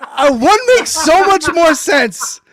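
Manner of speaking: mocking voice. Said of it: "I was just like".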